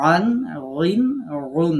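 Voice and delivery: punchy throat-pressed drill of আন, রিন, রুন; throat-pressed ain. Shown as a demonstration. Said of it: "কণ্ঠনালীর মধ্যে চেপে ধরে উচ্চারণ করতে হবে".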